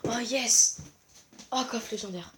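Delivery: normal talking voice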